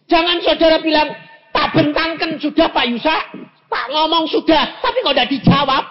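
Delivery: high, loud mock complaint to God; full-force preaching. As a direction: preach it loud, voicing an impatient believer.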